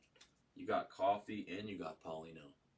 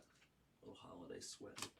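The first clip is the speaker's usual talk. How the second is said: breathy whispered aside; whispering; 14 dB quieter than usual. Real, not acted. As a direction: whisper it under your breath. Trailing joking aside.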